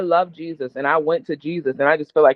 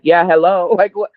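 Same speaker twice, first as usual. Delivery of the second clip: louder, mock-obvious yeah hello; husband stating the obvious, a bit louder; duh tone; 2 semitones higher, 4 dB louder than usual. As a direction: act like it's plainly obvious. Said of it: "And he's just like".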